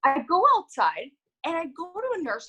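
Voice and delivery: ordinary talk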